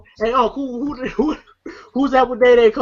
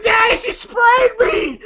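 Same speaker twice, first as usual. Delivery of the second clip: high, slow, wailing cry of a son; whiny crying kid; 13 semitones higher, slower than usual. Retold or imitated. Son wailing to his daddy after pepper spray, high teary cry. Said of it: "they're like".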